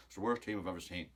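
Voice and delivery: lightly voiced pundit verdict; a hint of Roy Keane's bluntness; quoting pundit. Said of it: "Roy Keane".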